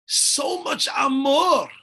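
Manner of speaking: slow, loud, high burst; emphatic. Real, not acted. Teacher stressing a father's overflowing love, loud and slow. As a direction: belt it out with feeling.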